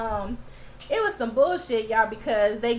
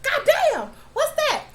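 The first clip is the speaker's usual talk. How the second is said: high, loud startled yell; shocked shout; 6 dB louder than usual. Real, not acted. Viewer watching a monster tear an arm off, loud shocked yell. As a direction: yell it like you just saw an arm fly off.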